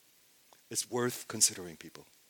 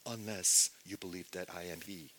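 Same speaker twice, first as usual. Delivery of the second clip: usual speaking voice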